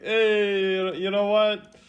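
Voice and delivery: cocky shrug at a beating; tough-guy bravado. Pretend. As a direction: act like the hits don't hurt. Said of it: "'cause it's like".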